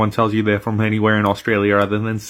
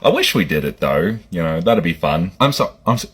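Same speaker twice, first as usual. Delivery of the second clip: usual speaking voice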